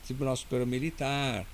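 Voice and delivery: ordinary talk, nothing marked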